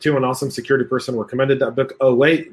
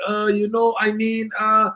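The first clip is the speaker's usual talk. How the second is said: put-on halting Hebrew accent; 8 semitones higher than usual. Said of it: "they're breaking English".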